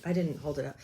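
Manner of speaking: usual speaking voice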